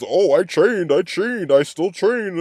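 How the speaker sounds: a hint of mocking the player; mocking Jacobs. Fan mocking the running back, lightly high and sarcastic. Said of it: "he says".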